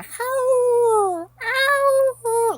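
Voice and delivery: high, loud, drawn-out yowling "howl"; cat howl imitation. Imitating the cat Bitchy howling at the shut door, high loud yowl. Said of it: "she will scratch and scratch and scratch and".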